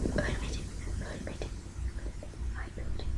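barely audible nervous whisper; hushed, holding still to survive; tense whisper. Real, not acted. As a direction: whisper it under your breath. Tense moment of nearly dying.

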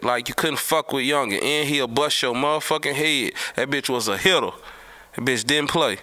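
normal talking voice

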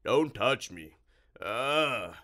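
loud creature recoil; disgusted monster. Acted. Monster refusing to touch the boys, loudly revolted. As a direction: fend them off with a revolted groan.

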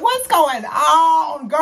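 slow, high, loud startled shout; alarmed shout. Retold or imitated. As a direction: shout out in shock at the weapon. Mom grabbed a weapon, scared.

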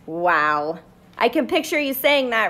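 ordinary delivery, nothing marked

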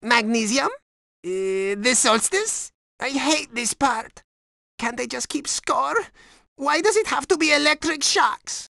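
high, anxious voice; nervous contestant. Acted. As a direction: guess in dread of a shock. Nervous quiz contestant facing electric shocks.

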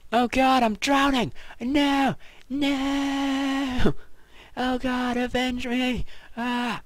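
high, loud hammed-up dying cries; overacted death scene. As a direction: ham up drowning, crying for revenge. Overacted drowning in game.

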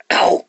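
short, abrupt cartoon "ow"; sudden exclamation. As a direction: cut the buzz off with a sudden yelp. Sudden end of mosquito's buzz.

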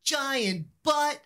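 high, drawn-out holler; retold rock yell. Streamer quotes the singer's line from the song, yelled. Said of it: "He just yells".

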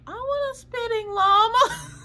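put-on high, slow childish whine; wanting the spitting llama like a child; whiny kid voice. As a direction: beg like a little kid who wants the toy. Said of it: "And I'm like".

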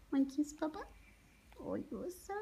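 ordinary talk

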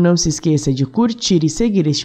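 bright friendly podcast host; cheerful podcast outro. Real, not acted.

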